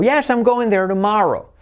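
"Yes, I'm going there tomorrow" in one high run; affirmative intonation. Shown as a demonstration. Teacher models affirmative, high connected tune. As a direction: launch high on YES, keep it flowing. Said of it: "esto sería la entonación".

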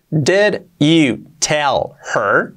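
very slow "Did you tell her", long gaps between words; one by one, well pronounced. Shown as a demonstration. Teacher recapping the words, slow and separated. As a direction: set each word of "Did you tell her" apart. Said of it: "una por una, bien pronunciadas".

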